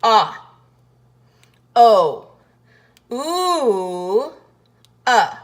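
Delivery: loud, long drawn ah, O, ooh, uh; vowel sounds of O. Shown as a demonstration. Teacher sounding out the four sounds of O. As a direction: sound out each vowel of O slowly. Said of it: "lowercase o".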